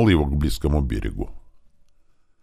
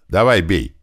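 firm, slightly raised order; firing command; 3 semitones higher, 4 dB louder than usual. A narrator's reading. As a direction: give the gunner the order to fire. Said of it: "командовал Илья наводящему". (командным голосом)

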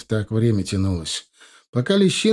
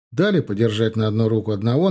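plain reading voice

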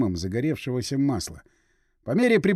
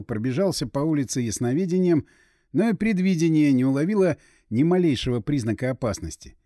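ordinary delivery, nothing marked

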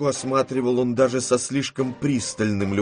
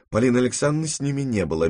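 ordinary delivery

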